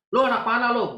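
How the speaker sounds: a high, loud street-tough challenge; tough street thug. Retold or imitated. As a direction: square up to a rival like a market hoodlum. (nada preman menantang, tinggi dan keras)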